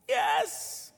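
high whispered yes; quiet high thrilled kid; excited kid. Retold or imitated. Cousin thrilled in the car, a high whispered yes.

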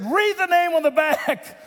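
high, insistent acted order; mock exasperated command. Coach orders player to read his shirt, mock exasperated command. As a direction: play the coach at the end of his rope.

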